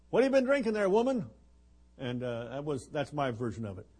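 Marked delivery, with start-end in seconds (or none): 0.1-1.3 loud, higher, scolding demand; angry accusation. Retold or imitated. Eli scolds Hannah for seeming drunk, angry loud rebuke. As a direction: bark it at her like an outraged priest. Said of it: "Eli got angry with her and said".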